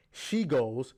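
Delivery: ordinary delivery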